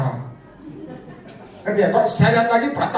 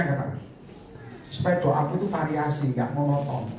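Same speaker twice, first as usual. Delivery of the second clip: normal talking voice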